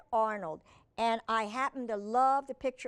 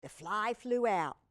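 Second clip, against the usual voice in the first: ordinary delivery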